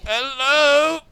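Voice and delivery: high, loud, rising hello; drawn-out parroted hello; addled old teacher. Acted. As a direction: call out blankly, stretched and high.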